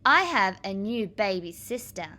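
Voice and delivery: ordinary talk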